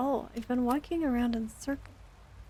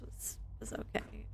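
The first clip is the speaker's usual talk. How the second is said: breathy half-whispered aside; hushed throwaway mutter; 14 dB quieter than usual. Real, not acted. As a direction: drop to a whisper, almost to yourself. Muttered aside while gaming.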